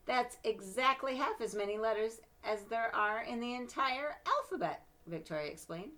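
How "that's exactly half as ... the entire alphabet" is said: higher, louder smug lecturing; know-it-all girl. Acted. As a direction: show off what she knows.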